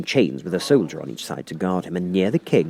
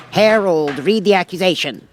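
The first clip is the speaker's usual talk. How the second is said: high, loud royal command; the king giving an order; 9 semitones higher, 6 dB louder than usual. Acted. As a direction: order the herald about pompously. The king's voice.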